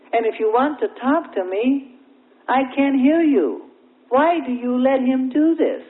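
high-pitched, frustrated appeal; acted-out troubled prayer; disturbed plea. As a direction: protest to the Lord over the interruption. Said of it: "he became disturbed in his spirit".

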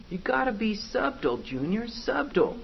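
high, coaching gangster voice; gangster demon boss. Acted. Boss corrects bumbling Junior, gangster-style. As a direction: coach a clumsy underling in subtlety. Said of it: "act like gangsters".

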